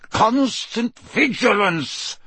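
high, slow bellowed motto; roar. Acted. Moody roars his motto at startled students. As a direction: roar it to make the class jump. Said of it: "he roared".

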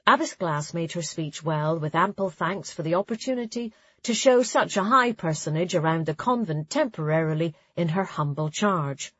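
plain reading voice

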